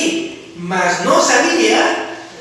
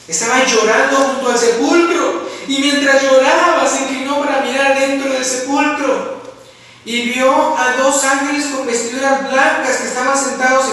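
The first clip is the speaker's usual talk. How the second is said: raised, ringing preacher's reading; preaching scripture; 5 semitones higher, 3 dB louder than usual. Acted. Preacher reads the tomb scene to the church, raised and ringing. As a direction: proclaim the Gospel passage to the congregation. Reads passage at full force.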